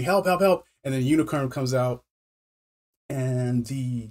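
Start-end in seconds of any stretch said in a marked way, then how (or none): 0.0-0.7 mild mock cry for help; higher-pitched retold yelling, held back; imitated scream. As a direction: call for help without really screaming. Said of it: "he's screaming".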